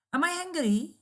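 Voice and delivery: "Am I hungry?" high, with a strong push on I; emphatic exclamatory question. Shown as a demonstration. Teacher demonstrates a positive exclamatory question, emphatic. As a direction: say it like of course you are. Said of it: "expressing a strong positive conviction".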